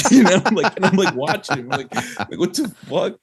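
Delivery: high-pitched, breaking into laughter; laughing while telling. Real, not acted. Singer retelling the drummer's odd percussion take, cracking up. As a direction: tell it through helpless laughter.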